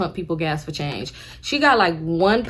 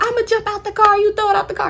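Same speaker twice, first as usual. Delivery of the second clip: high shrieking aunt; 13 semitones higher, 3 dB louder than usual. Retold or imitated. Aunt threatens to jump out.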